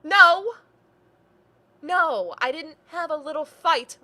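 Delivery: loud, high, sharp refusal; indignant denial. Acted. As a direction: fling the denial back loudly.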